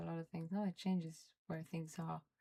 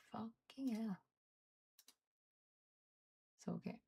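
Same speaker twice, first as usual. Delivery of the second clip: normal talking voice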